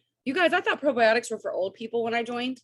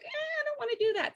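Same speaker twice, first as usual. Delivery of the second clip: whiny reluctant member; 4 dB quieter than usual. Retold or imitated. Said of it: "you're like".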